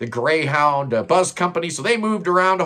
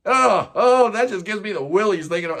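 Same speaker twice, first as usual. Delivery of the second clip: disgusted; 4 semitones higher than usual. Real, not acted. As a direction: recoil at the thought, squirming. Disgust at celebrity couple.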